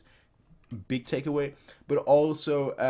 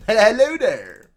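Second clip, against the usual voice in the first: leering hello; 7 semitones higher, 11 dB louder, much slower than usual. Acted. Leering greeting, drawn out.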